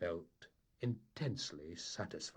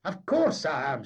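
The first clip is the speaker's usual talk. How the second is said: loud, firm insistence; emphatic; 9 semitones higher, 12 dB louder than usual. A narrator's reading. Mate assuring the captain, loud and firm. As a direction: nail it down for him. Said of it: "said Dougie emphatically".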